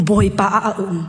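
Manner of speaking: low-pitched, measured statement; low declaration. Real, not acted. First saying of repeated declaration. (aw niam tein)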